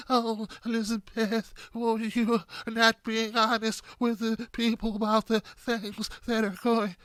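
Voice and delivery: slow, soft, pleading mock of Elizabeth Warren. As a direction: whimper it out slowly like a scolded senator. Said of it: "like she's about to burst into tears".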